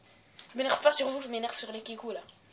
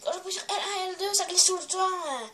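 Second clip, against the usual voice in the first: whiny gamer complaint; 6 semitones higher than usual. Retold or imitated. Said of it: "Ils disent".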